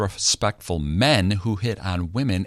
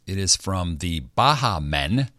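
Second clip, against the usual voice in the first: ordinary talk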